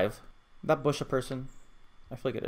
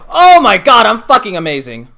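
high, loud triumphant scream; ecstatic shout; 10 semitones higher, 21 dB louder than usual. Real, not acted. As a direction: scream with disbelieving joy. Triumphant outburst after surviving.

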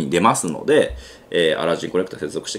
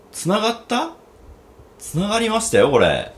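surprised; 12 semitones higher than usual. Real, not acted. Connection suddenly works.

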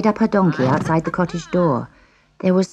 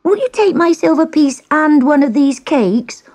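put-on high, pleading girl; begging girl; 10 semitones higher, 4 dB louder than usual. A narrator's reading. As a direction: keep begging. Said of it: "begged the girl".

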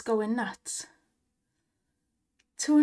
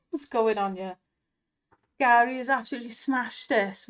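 normal talking voice

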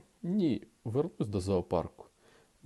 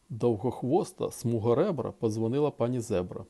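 usual speaking voice

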